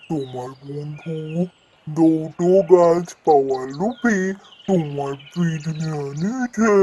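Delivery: lightly voiced wise old tree, calm; a hint of a gentle talking tree; tree friend voice. Tree friend speaks to Jadu.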